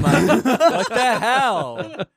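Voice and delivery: slow, high laugh of shock; laughing disbelief. Real, not acted. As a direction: crack up in disbelief.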